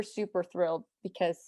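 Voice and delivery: normal talking voice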